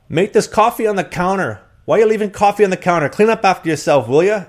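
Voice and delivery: loud, very high mock Irish nagging; Irish accent. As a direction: imitate an Irish roommate telling someone off. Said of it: "work on your Irish accent".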